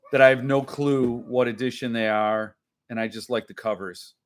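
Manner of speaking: ordinary talk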